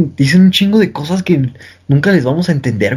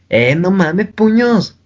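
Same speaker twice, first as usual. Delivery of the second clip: mock Monterrey accent, higher, sing-song; regio accent; 4 semitones higher, much slower than usual. Mocking how regios talk, a Monterrey accent. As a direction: poke fun at how a regio talks. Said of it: "Ellos hablan así tan, tan cagado como".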